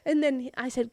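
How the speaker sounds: ordinary delivery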